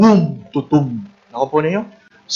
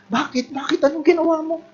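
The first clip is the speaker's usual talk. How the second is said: shocked questioning; 13 semitones higher than usual. Acted. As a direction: act out scandalized curiosity. Acted shocked questioning of sinner.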